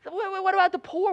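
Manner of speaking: rising to a high, loud indignant cry; mock outrage from the crowd; a hint of a shocked critic; scandalized onlooker. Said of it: "people may look around and say".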